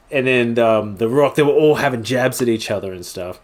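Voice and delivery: ordinary talk, nothing marked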